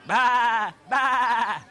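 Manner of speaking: high bleating baa baa; sheep bleat. A narrator's reading. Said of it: "said the sheep".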